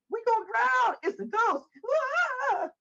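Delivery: high hammed-up wail; mock hollering. Teasing the men in the Bible, high mock hollering. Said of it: "They were hollering".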